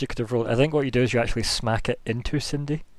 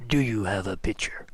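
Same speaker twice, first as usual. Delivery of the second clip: whispered necromancer voice; 4 semitones lower, 6 dB quieter than usual. Acted. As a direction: breathe it out like a sinister necromancer. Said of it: "he's like".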